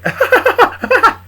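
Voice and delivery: high, loud burst of cackling; big hammed-up laugh; loud laughter. Small laughing at Charlie's voices, high cackle. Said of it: "He's laughing because he's very good at voices".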